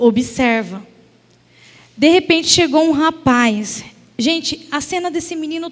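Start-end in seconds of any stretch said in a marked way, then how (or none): 0.0-0.8 low, hushed divine command; God's voice. Retold or imitated. God speaks to her heart, a low hushed command. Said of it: "o Senhor falou".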